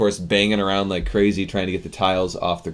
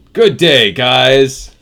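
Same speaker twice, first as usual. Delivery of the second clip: loud, higher-pitched catchphrase greeting; Simply Austin impression; 4 semitones higher, 13 dB louder, much slower than usual. Streamer quoting a fellow creator to chat, loud imitation. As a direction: belt out a borrowed greeting, higher than usual. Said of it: "I tend to quote Simply Austin".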